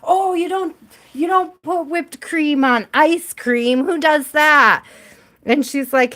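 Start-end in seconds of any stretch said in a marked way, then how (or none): 0.0-4.8 imitating an Australian accent, higher; mock Australian guy, indignant; a light imitation of an Australian accent. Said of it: "Australian or New Zealand accent".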